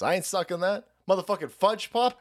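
ordinary talk

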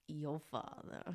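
low, soft, halting movie-quote impression; Matthew McConaughey. Player finally saying the quote, soft McConaughey impression. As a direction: drop it low and quiet, with pauses. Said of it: "That was my best Matthew McConaughey".

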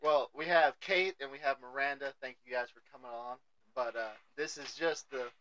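normal talking voice

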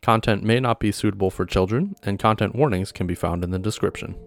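upbeat host intro over music; podcast intro. Acted. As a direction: announce the show brightly.

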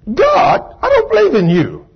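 high-pitched incredulous outburst; imitating an astonished unbeliever; startled disbelief. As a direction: protest at God in shock. Unbeliever answers God's voice.

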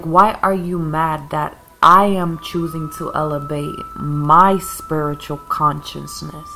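ordinary delivery

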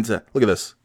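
normal talking voice

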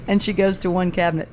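normal talking voice

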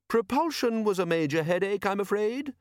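high-pitched, apologetic inventor; eccentric inventor. A narrator's reading. Inventor tells watchmen about his propulsion trouble, mildly apologetic. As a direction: explain it in a high, mild voice. Narrator voices the inventor Leonard.

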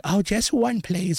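put-on high pleading little kid; lightly voiced begging child; mock kid voice. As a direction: beg like a greedy trick-or-treater.

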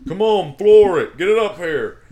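loud, high, drawn-out yell; retold urging shout. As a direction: holler at the forklift driver, in imitation. Coworker calls out to forklift driver.

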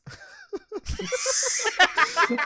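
loud high burst of laughter; high-pitched helpless laugh; big laugh. Real, not acted. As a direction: crack up loudly at the memory. Laughs at his own story.

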